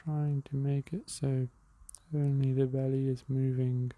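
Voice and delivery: calm, unhurried meditation guide with long pauses; even, measured breathing-exercise voice; guided meditation. Real, not acted.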